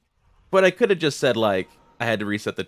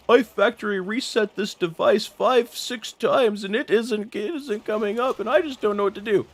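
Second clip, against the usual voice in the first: high, panicky caller voice; mock-panicked caller pleading for help; freaking-out customer; 10 semitones higher than usual. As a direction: play the flustered customer who can't fix it. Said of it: "this guy calls in freaking out".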